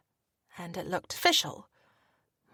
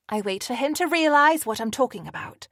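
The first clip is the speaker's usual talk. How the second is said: ordinary talk, nothing marked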